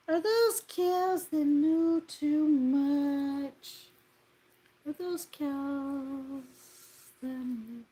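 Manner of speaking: milk each word; slow comic drawl. Pretend. Teasing a chat message, very slow drawn-out read.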